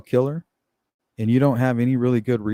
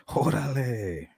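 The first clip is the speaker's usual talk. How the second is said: mock Mexican Órale; 7 dB quieter, slower than usual. Mexican exclamation after the quote.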